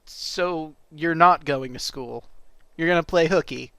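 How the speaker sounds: usual speaking voice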